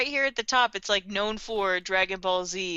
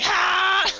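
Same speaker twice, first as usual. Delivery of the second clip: short high loud mock scream; imitated scream; 10 semitones higher, 8 dB louder than usual. Retelling the character screaming for apples, mock scream. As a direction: yell it like the hungry death god. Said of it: "Just screaming".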